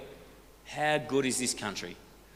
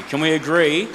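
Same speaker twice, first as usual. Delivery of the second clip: much louder, higher call to the crowd; rallying call; 6 semitones higher, 10 dB louder than usual. Real, not acted. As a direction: push the question out, rising at the end. Rallying the audience for agreement.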